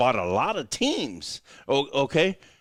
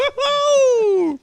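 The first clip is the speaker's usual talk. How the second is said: excited whoop; 8 dB louder than usual. Pretend. Hype cheer during promo intro.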